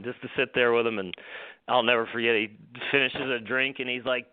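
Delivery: normal talking voice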